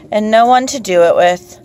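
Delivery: ordinary delivery, nothing marked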